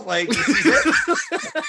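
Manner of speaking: slow, high, laughing through the words. Real, not acted. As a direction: crack up at your own joke. Laughing at his own joke.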